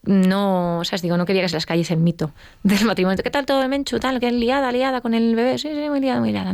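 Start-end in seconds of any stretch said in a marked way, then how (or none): 3.2-5.6 higher, sing-song friend voice; mock chatty friend. Imita a las amigas preguntando. 5.6-6.5 higher, airy brush-off; fake breezy reply. Su respuesta fingida.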